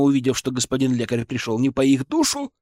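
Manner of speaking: ordinary delivery, nothing marked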